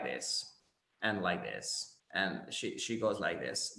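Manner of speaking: low, soft creaky fry; vocal fry demo. Shown as a demonstration. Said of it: "This is what we call the vocal fry".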